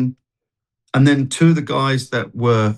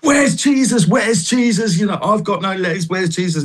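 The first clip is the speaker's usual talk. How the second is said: much higher, demanding cry, repeating the question; angry outburst; 8 semitones higher than usual. Retold or imitated. Man with no legs angrily demanding of the singer where Jesus is. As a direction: hurl the question out in bitter anger. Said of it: "he was really angry".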